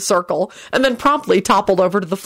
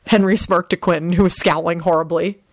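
ordinary delivery, nothing marked

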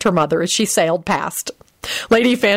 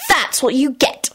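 mildly angry, higher snap; sharp, higher retort; snapped; 7 semitones higher than usual. A narrator's reading. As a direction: snap it at her. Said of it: "she snapped".

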